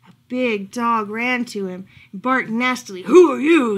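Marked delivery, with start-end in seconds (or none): 3.0-3.8 loud, nasty dog's challenge; snarling. A narrator's reading. Big dog to a stranger at his house, snarling. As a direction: snarl it out loudly. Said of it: "he snarled".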